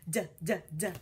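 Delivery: clipped "D, d, d" sound; letter sound demo. Shown as a demonstration. Teacher models letter sounds for kids, crisp. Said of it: "What's this one?".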